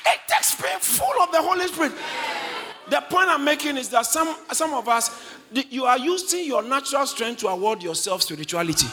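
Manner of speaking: high, loud, impassioned sermon; fervent preacher at full voice; full-force preaching. Acted. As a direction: hammer out each phrase to the congregation.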